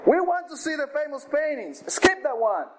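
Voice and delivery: high, impatient shout from the back of the room. Acted. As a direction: yell it out, fed up with the wait. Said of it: "a voice in the back of the room shouted".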